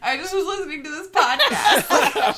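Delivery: high, loud tearful protest dissolving into laughter; mock tearful. Embarrassed worker explaining tears to a concerned colleague, mock tearful. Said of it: "with a red puffy face".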